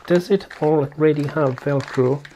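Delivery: ordinary delivery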